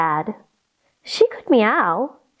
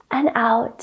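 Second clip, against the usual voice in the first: whispered breath out; 2 semitones lower than usual. Shown as a demonstration. Teacher guiding breath, whispering and out. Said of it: "Let's try it again".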